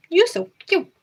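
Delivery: high cooing pet talk. Real, not acted. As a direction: coo up high at a beloved cat. Cooing at cat.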